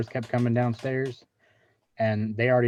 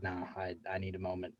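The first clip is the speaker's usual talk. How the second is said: quiet mock shaken; 4 semitones lower, 15 dB quieter than usual. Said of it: "I was like".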